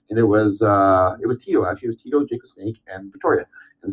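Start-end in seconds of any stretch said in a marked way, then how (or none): none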